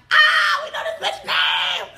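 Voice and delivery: loud, piercing shriek with laughter; gleeful scream. Retold or imitated. Friends learn the yelling woman's name, gleeful screaming. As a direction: scream it as a triumphant discovery.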